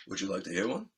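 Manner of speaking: imitating a cool star, low and soft; understated, hushed invitation; low-key offer. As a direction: drop it casually, low and quiet. Star's casual offer, retold.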